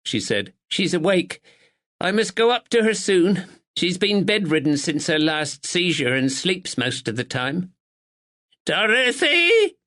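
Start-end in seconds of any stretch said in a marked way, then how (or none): 0.7-7.7 higher, prim village woman; a proper village neighbour, a touch anxious; spinster neighbour. A narrator's reading. Miss Bennet voiced. 8.7-9.8 put-on high, slow old woman calling upstairs; frail insistent call. A narrator's reading. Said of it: "frail but insistent".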